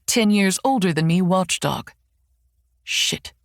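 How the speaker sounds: ordinary delivery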